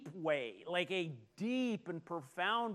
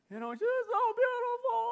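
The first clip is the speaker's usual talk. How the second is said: high, loud mimicked wail; hammed-up ugly-cry squeal; mock sobbing; 6 dB louder than usual. Husband imitating his wife weeping at a movie, mock sobbing. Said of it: "heavy sobbing".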